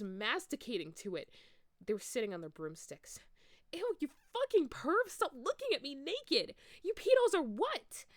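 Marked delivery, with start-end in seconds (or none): 3.7-8.1 much higher, louder shrieking outrage; disgusted scream. Acted. Ebony screaming at Snape and Lupin, high and loud. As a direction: shriek at the peepers. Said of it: "I screamed".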